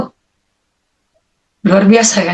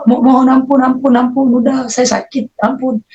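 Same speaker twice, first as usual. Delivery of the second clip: high, urgent plea for mercy; acted-out cry for mercy, not full scream; re-enacted begging; 4 semitones higher than usual. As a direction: beg in a raised, pained voice. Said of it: "Saya berteriak-teriak mohon ampun".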